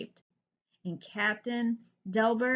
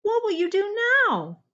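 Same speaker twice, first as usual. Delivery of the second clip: high, loud eager question; exclaimed; 12 semitones higher, 8 dB louder than usual. Acted. Jubal exclaiming to his rescued friends, high and loud. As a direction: burst out with it. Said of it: "Jubal exclaimed".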